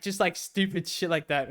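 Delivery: usual speaking voice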